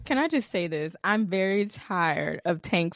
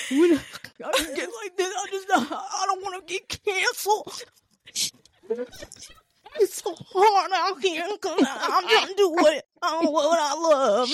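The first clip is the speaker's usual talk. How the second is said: high, laughing mock sob as Akademiks; mock crying Akademiks; 9 semitones higher than usual. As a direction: whine it out like a crying man, half laughing. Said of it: "I did see a little, a little piece of him crying".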